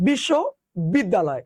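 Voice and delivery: বিশ্ববিদ্যালয় in two joined-up beats; word split once in the middle. Shown as a demonstration. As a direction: say bishsho, then biddaloy in one go. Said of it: "আরো দ্রুত উচ্চারণ করো".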